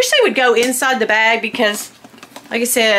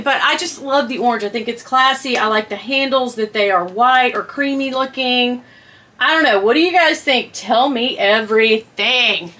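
normal talking voice

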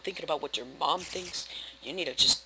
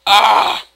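startled scream; 5 semitones higher, 18 dB louder than usual. Real, not acted. Attacked by mob.

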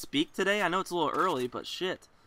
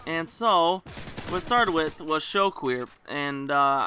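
ordinary talk, nothing marked

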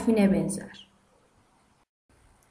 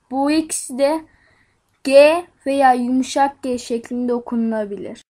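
usual speaking voice

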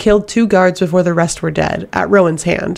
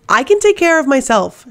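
higher and louder, a sharp snap; snapping; 7 semitones higher, 4 dB louder than usual. A narrator's reading. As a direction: bite back, stung at being protected. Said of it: "she snapped".

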